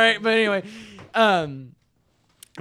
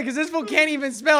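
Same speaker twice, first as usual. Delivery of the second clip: normal talking voice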